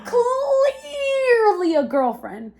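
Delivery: very high, very slow wailing "Clearly"; half-crying, drawn-out disbelief; dramatic dismay. Real, not acted. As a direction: stretch it out in mock despair. Shocked discovery of girlfriend texts.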